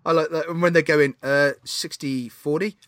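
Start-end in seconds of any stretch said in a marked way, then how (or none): none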